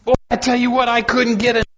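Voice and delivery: impassioned preaching. Acted. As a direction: preach it out, lifting the congregation. Preaches with rising fervor.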